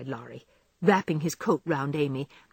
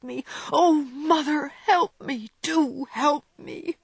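higher, crying plea; tearful pleading; 7 semitones higher than usual. Acted. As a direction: beg for help through tears. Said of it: "Jo cried harder than ever".

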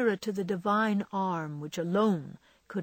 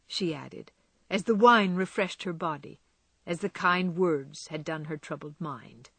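ordinary delivery

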